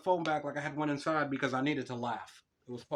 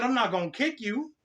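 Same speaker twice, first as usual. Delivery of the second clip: exasperated dad; 9 semitones higher, 6 dB louder than usual. Retold or imitated.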